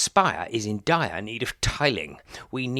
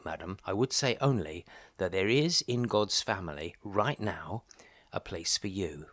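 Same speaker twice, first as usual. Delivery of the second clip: normal talking voice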